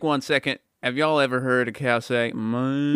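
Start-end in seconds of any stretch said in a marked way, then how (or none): 2.3-3.0 southern cow moo. Retold or imitated. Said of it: "It's a deep southern moo right there".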